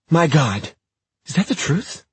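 high, loud disbelief; shocked. Acted. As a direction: gasp of desperate hope. Tessa gasps relief.

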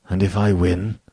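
plain reading voice

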